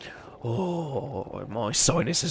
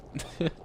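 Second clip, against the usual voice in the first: soft rueful laugh; 4 semitones higher, 8 dB quieter than usual. Acted. Villain laughs at himself.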